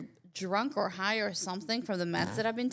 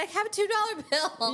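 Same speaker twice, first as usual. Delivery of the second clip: bright squeaky voice, much higher; high chirpy reply; 10 semitones higher, 3 dB louder than usual. Retold or imitated. Telling the searching officer about her two dollar bill, in a high squeaky voice. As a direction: chirp it up high like an innocent traveller.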